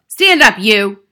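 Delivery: bullying headmistress yell; shouted order. A narrator's reading. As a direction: shout a pupil to his feet. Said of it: "she shouted at him".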